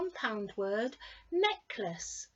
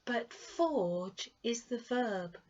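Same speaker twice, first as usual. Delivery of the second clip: ordinary talk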